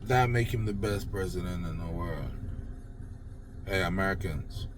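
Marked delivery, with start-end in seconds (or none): none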